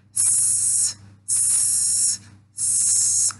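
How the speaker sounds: س as a soft voiceless sss; a soft hissing sss; isolated s hiss. Shown as a demonstration. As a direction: hiss س quietly, no vowel. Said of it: "چنین تلفظ می شود".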